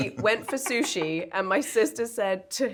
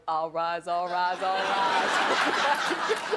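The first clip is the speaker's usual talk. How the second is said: mock court bailiff; 3 semitones lower, much slower than usual. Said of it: "in that courtroom and go".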